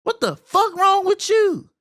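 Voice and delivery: high, loud disbelieving yell; high incredulous shout; outraged shout. Real, not acted. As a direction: scream it at the dad on screen. Yelling at game dad.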